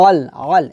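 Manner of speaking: "আল" squeezed from the middle of the throat; pressed from mid-throat. Shown as a demonstration. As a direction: press the opening vowel of "আল" in the throat. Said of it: "হলকের মধ্যখান থেকে আমরা চাপ দিয়ে". (গলার মাঝখান থেকে চাপ দিয়ে "আল")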